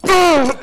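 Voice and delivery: high, loud boom; imitated crash. Comic acts being thrown down, loud crashing boom.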